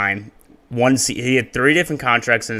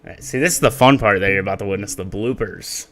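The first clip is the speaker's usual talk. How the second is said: usual speaking voice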